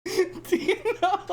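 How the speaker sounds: high squealing giggle fit; can't stop laughing; giggling. Real, not acted.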